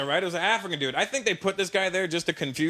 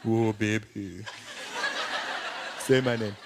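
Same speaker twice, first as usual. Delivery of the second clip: put-on deep, sultry accented lover; hammed-up low bedroom voice; mock lovemaking; 8 semitones lower, slower than usual. Imagined dealer making love.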